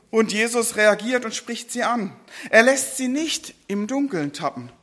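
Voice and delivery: usual speaking voice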